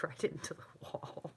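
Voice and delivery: whispered through laughter; laughing whisper. Real, not acted. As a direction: giggle it under breath.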